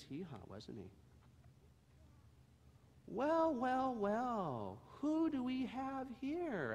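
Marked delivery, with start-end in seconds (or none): 3.1-6.7 higher, friendly welcoming voice; kind man. Acted. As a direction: greet the little donkey kindly. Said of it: "a kind man asked".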